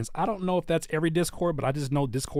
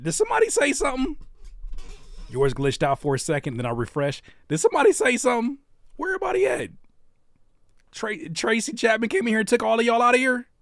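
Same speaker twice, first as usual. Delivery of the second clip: calling out; 9 semitones higher than usual. Real, not acted. Streamer calling out silent chat.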